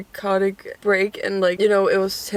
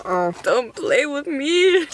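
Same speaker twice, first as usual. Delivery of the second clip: high, slow sassy drawl; 6 semitones higher, slower than usual. Real, not acted. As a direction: tease it out slowly with sass. Reacting to tasty food.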